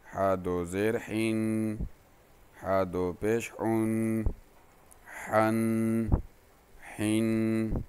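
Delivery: slow, even sing-song spelling chant; recitation drill. Shown as a demonstration. Teacher drilling students on tanween sounds, in an even sing-song chant. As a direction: spell out each letter like a patient Qaida teacher.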